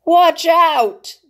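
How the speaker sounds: high, loud shout; shouted warning. Acted. Jonathan shouting warning at bombers overhead. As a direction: yell it to save them.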